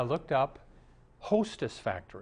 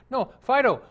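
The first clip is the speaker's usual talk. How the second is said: higher, louder prompting of a dog; eager dog owner; 11 semitones higher, 8 dB louder than usual. Acted. Man showing off his talking dog to theater manager, eager. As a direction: coax the dog to perform, raised voice.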